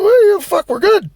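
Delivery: high-pitched, mock-casual brush-off; breezy dismissal. Acts out his carefree reply to mechanic.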